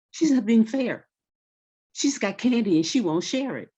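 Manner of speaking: put-on a kid tattling to grandma about candy; whiny kid complaint. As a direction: complain like a child wronged. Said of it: "would say".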